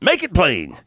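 elder calling out from the platform, mock shout; retold yell. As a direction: call out from the platform. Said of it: "one of the elders ... yells out".

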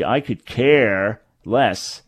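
slow, "care" stretched long and emphasised; sarcastic, leaning heavily on "care"; stress on CARE. Shown as a demonstration. Host demonstrating Yiddish sarcasm, stressed "care". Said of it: "here the emphasis would be".

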